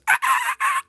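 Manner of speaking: tiny high squeaking yelps imitating the Rhino; mocking squeaks. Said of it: "He, like, squeaks".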